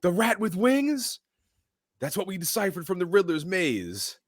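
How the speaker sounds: lightly voiced cop grilling a suspect; Gordon, mocked. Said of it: "Gordon goes".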